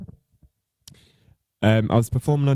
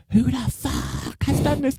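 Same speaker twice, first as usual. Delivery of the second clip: high-pitched retold scream. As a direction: shriek it like you've lost it. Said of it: "I scream at the top of my lungs".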